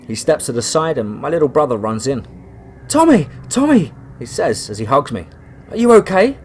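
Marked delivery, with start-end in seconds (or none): 2.9-3.9 very high, loud excited calling; a little boy thrilled to see his brother; little brother calling out with joy; excited child. Acted. 5.7-6.4 put-on high, loud little-kid voice; little brother worried about Tommy; small child. Little brother asks anxiously.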